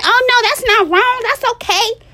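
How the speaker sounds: high, loud sarcastic parody of enabling advice; exaggerated high voice of a permissive adviser; mocking reassurance. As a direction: mimic the cheerleaders in a sugary high voice.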